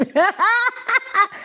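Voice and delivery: high-pitched burst of laughter; cackling at the story. Real, not acted. Laughing at own joke.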